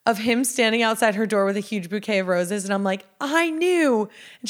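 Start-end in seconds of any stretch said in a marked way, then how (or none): 3.2-4.1 high, excited I-knew-it; retold higher-pitched happy gush; delighted vindication. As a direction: pitch it up, thrilled to be proved right. Triumphant reaction to screenshot.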